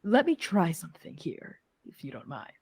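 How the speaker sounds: hushed, soft aside to the party; conspiratorial whisper. Acted. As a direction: whisper a cautious plan to your companions. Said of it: "I'm gonna whisper and I'm gonna say".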